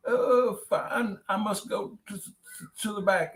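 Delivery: high squeaky nervous voice. Acted. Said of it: "The man squeaked out a".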